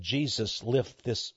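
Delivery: soft, low retold prayer; hushed plea to Jesus; quiet prayer. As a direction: pray gently over her. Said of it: "and I said".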